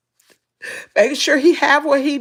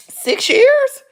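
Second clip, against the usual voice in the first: incredulous; 12 semitones higher, 5 dB louder than usual. Real, not acted. Disbelief at how long.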